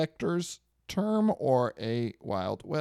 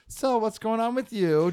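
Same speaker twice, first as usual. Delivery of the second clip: higher, carefree chatty voice; oblivious dog owner; 10 semitones higher than usual. Retold or imitated. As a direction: imitate an owner blithely making small talk. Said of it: "owner's just, like, sitting there like".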